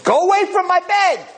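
put-on high-pitched old man's yell; screaming. Old man drives roommate from his bed, screaming. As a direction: explode at him in a high shout. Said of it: "he's screaming and yelling".

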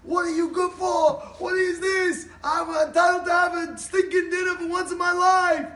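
high, furious acted shouting; loud, enraged tirade; screaming husband. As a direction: yell at the top of your lungs, stretching the words. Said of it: "He starts screaming on top of his lungs".

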